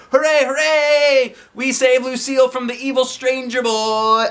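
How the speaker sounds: loud high triumphant cheer; high kid's victory shout. A narrator's reading. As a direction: celebrate a rescue at full voice. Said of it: "we shouted".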